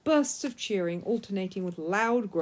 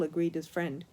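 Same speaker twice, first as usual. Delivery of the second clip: ordinary delivery, nothing marked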